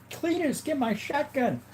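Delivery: squeaky high backwoods holler; high-pitched hillbilly voice. Pretend. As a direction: call out like a cartoon hillbilly kid. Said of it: "I sound like my name should be Cletus".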